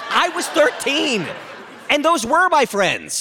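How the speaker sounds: high, exasperated shout; indignant protest. Acted. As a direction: protest hotly, stretching the key words. Son protests old accusation.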